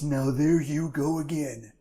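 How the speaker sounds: slow Reagan impression; Ronald Reagan impression. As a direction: say it like Reagan in a debate. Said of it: "Ronald Reagan is".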